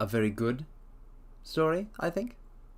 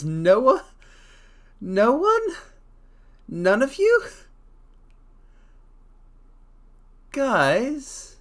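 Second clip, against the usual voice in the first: high, loud disbelief; higher and louder, can't believe it; shocked question to chat; incredulous; 11 semitones higher, 7 dB louder, much slower than usual. Real, not acted. Disbelief nobody read it.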